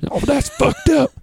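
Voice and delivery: high, slow falsetto Kathy confronting him; imitating Kathy. Comic imitates the angry booker in a high, slow falsetto. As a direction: squeak out the accusation slowly.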